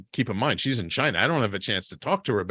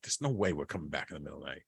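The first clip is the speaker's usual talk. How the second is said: suppressed anger; 9 semitones lower, 9 dB quieter, much faster than usual. Retold or imitated. Angry reply held back.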